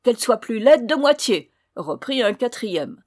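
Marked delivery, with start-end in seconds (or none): 0.0-1.5 loud, raised-pitch curse; cursing dwarf. A narrator's reading. Fourth dwarf cursing the rude girl, loud and spiteful. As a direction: fling the curse at her.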